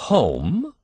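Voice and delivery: incredulous. A narrator's reading. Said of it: "repeated Mister Button incredulously".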